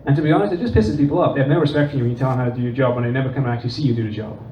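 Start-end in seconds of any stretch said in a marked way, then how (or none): none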